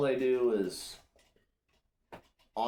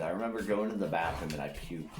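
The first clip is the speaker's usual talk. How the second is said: ordinary delivery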